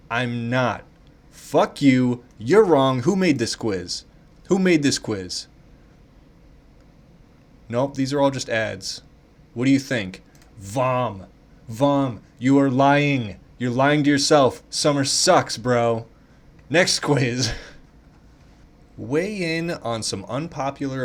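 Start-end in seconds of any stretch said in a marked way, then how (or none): 1.3-5.5 loud playful outrage; hammed-up fury at a quiz; mock angry rant. Real, not acted. Ranting at the quiz result. 9.5-17.5 loud, emphatic playful scolding; hammed-up loud lecture; loud mock rant. Real, not acted. Ranting that summer sucks.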